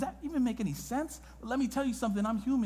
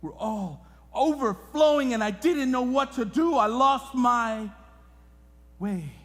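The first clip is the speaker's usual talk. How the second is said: rising preaching; 6 semitones higher, 6 dB louder than usual. Acted. Preaching climax about overflowing pain.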